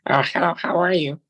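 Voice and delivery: light mock American greeting; American accent. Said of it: "speak with an American accent".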